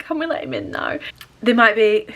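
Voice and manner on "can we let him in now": whispered plea, much softer and higher; pleading whisper. Retold or imitated. Asking family to let the crying dog in, whispered plea. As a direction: softly beg to let the dog in.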